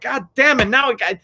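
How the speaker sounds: a hammed-up annoyed driver cursing; pissed-off rant. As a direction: vent at the spilled coffee and the dog. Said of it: "you get pissed off, like".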